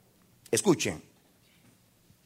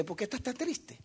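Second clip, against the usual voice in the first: hushed divine voice; 7 semitones higher, 8 dB quieter than usual. Retold or imitated. God's voice in retold vision.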